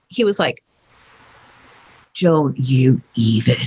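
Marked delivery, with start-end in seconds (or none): 2.2-3.6 low, slow warning. Retold or imitated. Young son refusing a parent's demand, warning. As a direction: imitate a son drawing the line, low and slow.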